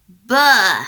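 loud disgusted exclamation; disgusted bah. A narrator's reading. Storyteller grossed out by the wolf's teeth. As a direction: recoil at the filthy teeth.

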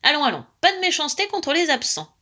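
high, chiding interruption; cutting in. A narrator's reading. Aunt stopping gossip about the absent, chiding. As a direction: cut the gossip short. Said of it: "coupa tante Zinnia".

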